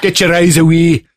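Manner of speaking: mock Scottish scolding; Scottish accent. Warning a boy off a naked mannequin, Scottish accent. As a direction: order it in a put-on Scottish accent. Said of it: "funnier than a Scottish accent".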